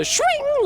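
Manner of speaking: very high sudden violin sting; imitated string screech. Shown as a demonstration. Player imitating the scare sound when the scary thing happens, string screech. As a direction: mimic a horror-film string screech. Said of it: "like the sudden string screech when the scary thing happens".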